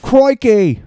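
loud, high Aussie exclamation; hammed-up Australian cry; Australian accent. Capping the mimicry with a loud Australian yell. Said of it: "Crocodile Dundee".

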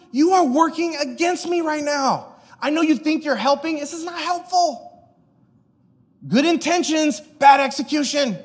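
high-pitched, emphatic reproof; exasperated correction. Acted. Jesus telling Peter he isn't helping, exasperated.

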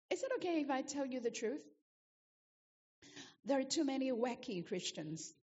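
ordinary talk

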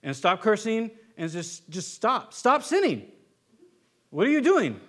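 scolding freak-out at a sinner. Retold or imitated. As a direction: snap at them like a nagging parent. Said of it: "have a freak out and say".

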